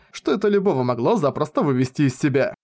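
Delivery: ordinary delivery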